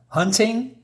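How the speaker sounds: high, rising echo of disbelief; incredulous question. Acted. Reviewer reacting to a trophy wife going hunting, incredulous question. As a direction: query it in mock disbelief.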